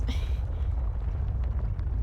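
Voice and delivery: short unvoiced laugh; real exasperated laugh; breathy laugh. Real, not acted. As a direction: laugh it off in disbelief. Laughs at rotating room.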